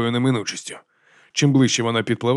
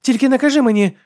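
high, loud retort; snapping; 13 semitones higher, 6 dB louder than usual. A narrator's reading. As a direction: snap back in a raised voice. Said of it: "огризнулася Алекс".

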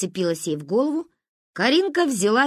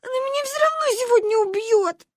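very high, despairing wail; bawling. A narrator's reading. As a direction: bawl as if doomed. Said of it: "заревела Маня".

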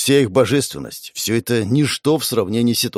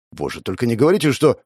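plain reading voice

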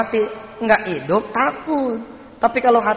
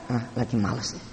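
lazy, reluctant mumble; 7 semitones lower, 10 dB quieter than usual. Acted. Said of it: "Apa kata si Malik?".

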